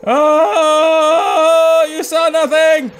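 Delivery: mock taunt. Taunting the enemy again.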